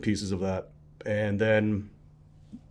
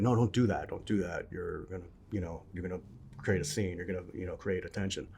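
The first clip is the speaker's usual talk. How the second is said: soft, hushed retelling of a mother's warning; understated parental warning, trailing softer; cautioning mother; 3 semitones lower, 8 dB quieter than usual. Retold or imitated. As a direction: lightly voice mom cautioning, dropping low and quiet. Retelling mom's cautioning words.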